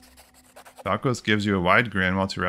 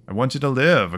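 put-on raised, higher and louder sharp girl's voice; sharp retort; 5 semitones higher, 5 dB louder than usual. A narrator's reading. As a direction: cut him off sharply, pitched up. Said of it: "a girl's voice says sharply".

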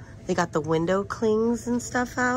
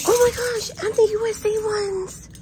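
bursting with real excitement; excited squeal. Real, not acted. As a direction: squeal over the new hats. Shopper thrilled by new items.

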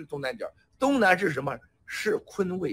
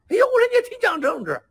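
teasing exclamation; 6 dB louder than usual. Retold or imitated. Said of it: "班农说不行".

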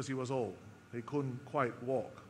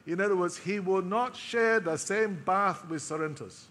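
ordinary talk, nothing marked

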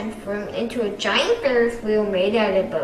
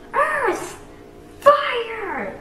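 high elemental battle cry; yelled; 11 semitones higher than usual. Acted. Ninja calling out their elements, high. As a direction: call out the elements. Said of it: "yelled Kai".

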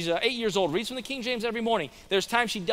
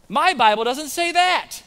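high, loud, slow mock protest; imitated objecting lady; 7 semitones higher, 7 dB louder than usual. Another lady objects, more forcefully.

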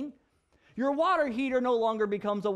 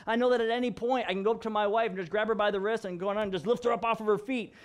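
ordinary delivery

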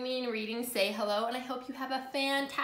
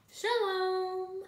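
high, held, drawn-out hello; echoed greeting; 9 semitones higher than usual. Acted. Greeting returned after the dog barks, high and held. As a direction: hold the last syllable up high.

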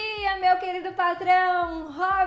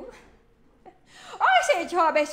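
laughing greeting; 5 dB louder, much slower than usual. Real, not acted. Driver greeting a viewer on the live, laughing. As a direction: burst out laughing as you greet.

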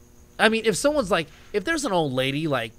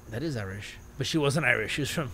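ordinary delivery

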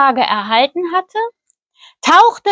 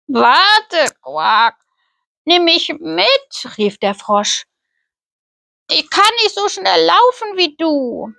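higher, lightly voiced frog calling after her; pleading frog; 4 semitones higher than usual. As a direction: call out, begging her to wait. Frog calls after princess.

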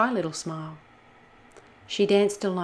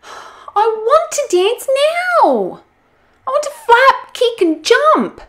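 very high, loud, drawn-out demanding; whiny tantrum; 14 dB louder, slower than usual. Acted. Olga wanting to dance now, high loud tantrum. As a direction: wail it like a stamping child.

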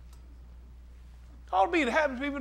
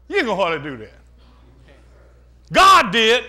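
full-force preaching; 9 dB louder than usual. Acted. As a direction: shout it out to the congregation.

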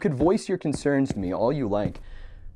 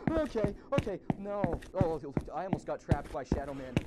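much softer, breathless and higher after a fright; hushed shaky relief after the scare; shaken relief; 6 semitones higher, 13 dB quieter than usual. Real, not acted. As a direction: gasp it out, still rattled.

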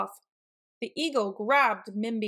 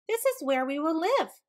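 high, eager little-animal voices; storybook excitement; excited; 6 semitones higher than usual. A narrator's reading. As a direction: agree with excitement, pitched up. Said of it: "they agreed excitedly".

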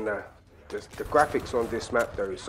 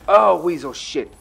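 loud curse; 5 semitones higher, 9 dB louder, slower than usual. Real, not acted. Frustrated gamer swearing at enemy.

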